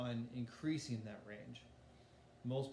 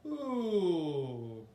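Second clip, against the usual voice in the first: descending siren slide; 5 semitones higher, 8 dB louder than usual. Shown as a demonstration. Teacher moving singers' voices, falling ooh glide. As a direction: start high, slide all the way down. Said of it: "just trying to move up your voice".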